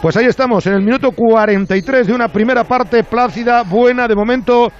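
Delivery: brisk radio football commentary; live play-by-play. Acted. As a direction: call the match live, steady pace.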